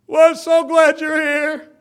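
high, loud tearful welcome at the door; crying for joy. Retold or imitated. Church greeter Millie welcoming visitors, tearful and loud. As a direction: mimic the greeter weeping for joy. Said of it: "she would just cry for joy".

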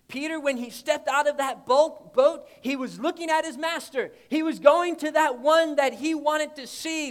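fiery preacher telling of Peter sinking; full-force preaching. Acted. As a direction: preach it, building the story.

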